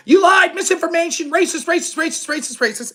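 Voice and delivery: high-pitched mock accusation, trailing downward; mock screaming. Host imitates interviewers screaming at a guest, mock shrill rant. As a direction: rattle it off like a hectoring mob. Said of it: "they're just screaming".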